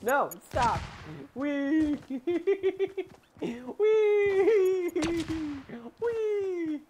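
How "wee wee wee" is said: very high squeaky wee; playful wee. Real, not acted. Playful wee while sliding.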